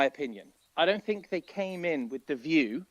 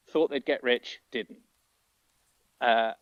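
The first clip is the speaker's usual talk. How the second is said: ordinary delivery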